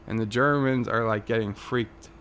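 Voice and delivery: ordinary talk, nothing marked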